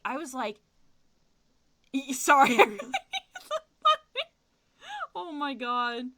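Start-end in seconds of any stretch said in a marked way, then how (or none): 2.6-3.6 sudden high burst of laughter. Real, not acted. Losing it while telling the robbery story, high laugh. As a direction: break up laughing.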